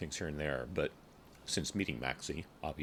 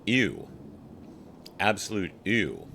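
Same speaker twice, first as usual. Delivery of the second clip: disgusted; 5 dB louder, slower than usual. Real, not acted. Tasting dill pickle kettle corn.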